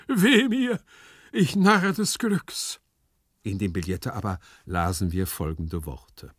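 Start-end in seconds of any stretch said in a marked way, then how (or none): 0.1-2.8 high, loud tragic wail; drawn-out theatrical lament; despairing cry. Acted. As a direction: wail in stagey despair, stretching the end. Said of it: "immer noch verzweiflungsvoll".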